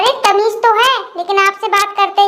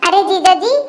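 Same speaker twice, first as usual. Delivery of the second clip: normal talking voice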